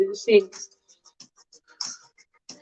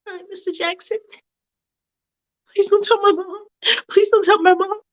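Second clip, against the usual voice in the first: put-on 1 scared pleading girl; 9 semitones higher than usual. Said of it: "Mia is like".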